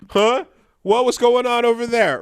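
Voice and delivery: loud, high double take; mock surprised onlooker. Player looking back from the computer, mock surprise. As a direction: call out as if just catching something behind you.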